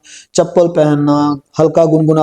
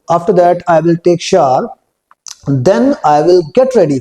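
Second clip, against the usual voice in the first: very slow, word-by-word model sentence; slow spoken drill; much slower than usual. Shown as a demonstration. Teacher spells out the sentence slowly for the class. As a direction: stretch out each word for learners to copy. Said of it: "इनको पहले बोल के देख लेते हैं".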